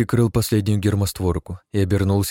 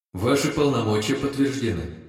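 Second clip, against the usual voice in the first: disembodied voice; 5 semitones higher than usual. Acted. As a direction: speak as the ship's AI. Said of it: "раздался голос отовсюду".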